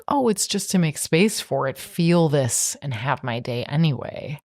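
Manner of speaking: ordinary talk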